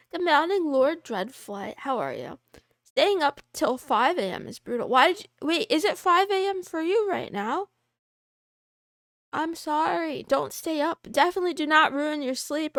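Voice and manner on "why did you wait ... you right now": ordinary talk, nothing marked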